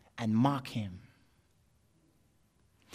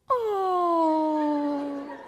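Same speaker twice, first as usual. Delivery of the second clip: long high held "ohhh"; swooning "oh"; 6 dB louder than usual. Acted. Girl suddenly noticing the suitor, swooning. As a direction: melt into a high, sustained coo. The swooning sound that thing caused.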